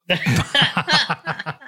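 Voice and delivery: loud, high burst of laughter; loud laughing. Real, not acted. Friend laughing loudly at her own fishing joke.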